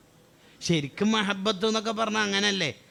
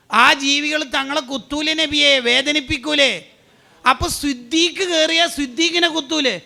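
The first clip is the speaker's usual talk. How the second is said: urgent retold dialogue; 6 semitones higher, 5 dB louder than usual. Retold dialogue with the Prophet, raised.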